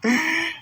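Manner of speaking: very high-pitched laugh. Real, not acted. Laughing at his joke about the long lost wife, high laugh. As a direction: crack up at your own joke.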